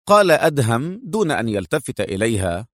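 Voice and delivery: plain reading voice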